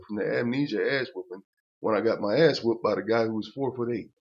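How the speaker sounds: usual speaking voice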